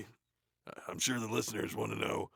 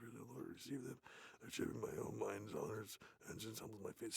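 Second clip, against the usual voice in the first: rushed whispered reading; fast whisper; 12 dB quieter than usual. Real, not acted. Racing through the play to finish, fast whisper. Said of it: "See how fast I did that?".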